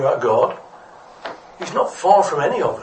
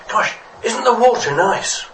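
slower, higher, pleased little fish; pleasantly marvelling; 8 semitones higher than usual. Acted. Little fish chatting to another fish, pleasantly marvelling. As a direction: marvel brightly at something nice, drawing it out.

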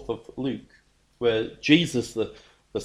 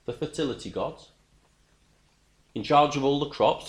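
ordinary talk

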